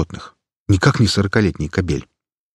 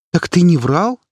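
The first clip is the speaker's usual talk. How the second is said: surprised; 11 semitones higher, 5 dB louder than usual. Acted. Girl surprised.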